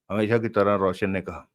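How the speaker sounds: usual speaking voice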